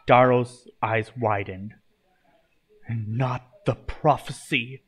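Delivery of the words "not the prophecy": slow, halting reaction; understated stammer, words drawn out; stammered dread. A narrator's reading. Daro hears of the Chosen Crafters' quest, stammering. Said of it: "he stammered".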